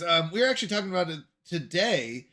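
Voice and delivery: normal talking voice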